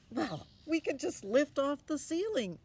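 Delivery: put-on confident boy. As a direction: toss off the fix like it's obvious.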